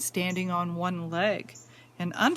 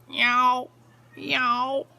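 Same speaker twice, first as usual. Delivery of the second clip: tomcat meow; 3 semitones higher, 5 dB louder than usual. Acted. Reading to a child, the tomcat's louder meow.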